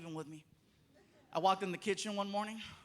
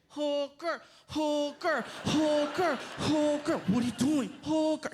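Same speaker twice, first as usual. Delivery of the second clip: put-on high, louder chanting child; high taunting child chant; kid tantrum; 6 semitones higher, 4 dB louder, slower than usual. Son throws a fit chanting.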